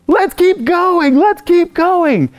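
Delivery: put-on a dad urging his kids on at the theme park; eager dad. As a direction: push the tired kids on, cheerfully. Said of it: "I'm like".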